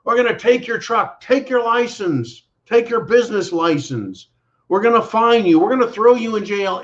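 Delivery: a hint of a menacing bureaucrat; mock government threat. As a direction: sneer out the threats like a bully official. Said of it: "They're like".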